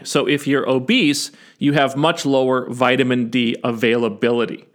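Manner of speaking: usual speaking voice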